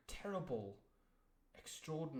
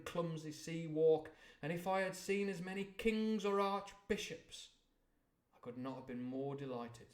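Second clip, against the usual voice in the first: steady, louder storybook reading; story narration; 3 semitones higher, 6 dB louder than usual. A narrator's reading. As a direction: paint the harbour for the listener. Reads novel passage aloud.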